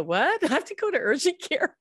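surprised question. Retold or imitated. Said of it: "I go".

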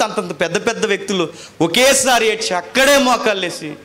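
high, loud, tearful sermon climax; fervent preacher's cry; impassioned preaching. Acted. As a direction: preach it with rising emotion, near tears. Emotional peak of testimony.

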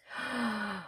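a quiet, breathy intake of wonder; soft gasp. Real, not acted. Unboxing the doll, a hushed gasp of wonder. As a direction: catch your breath softly. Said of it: "all the gasping".

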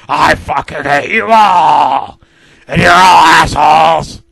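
loud, raging yell; villain screaming his hatred; furious shout. Acted. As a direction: blow up at all of them. Letter's outburst at everyone.

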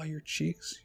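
ordinary delivery, nothing marked

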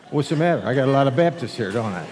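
usual speaking voice

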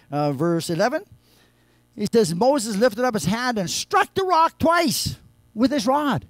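loud, high, driving sermon delivery; preaching at full force. Acted. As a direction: retell the rock story at full voice. Preaching Numbers story.

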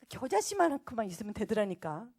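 ordinary talk

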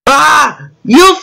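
loud, high angry shout at the fool; furious yell. Acted. Alien yelling at the reckless human, furious. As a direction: bellow it in outrage.

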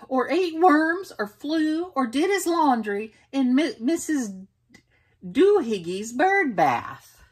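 high, loud, exasperated list of complaints; mother listing his bird habits; scolding. Acted. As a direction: keep scolding, piling up the faults. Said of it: "she scolded".

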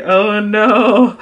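high-pitched comic dismay; hammed-up high moan; mock dismayed wail. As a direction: act out embarrassed panic. Retold panic at own crying.

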